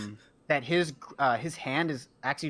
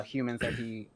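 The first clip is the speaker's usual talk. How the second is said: ordinary talk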